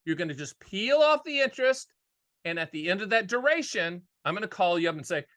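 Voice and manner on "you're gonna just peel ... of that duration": ordinary talk, nothing marked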